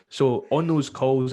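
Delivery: usual speaking voice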